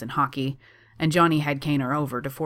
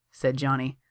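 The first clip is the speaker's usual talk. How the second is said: plain reading voice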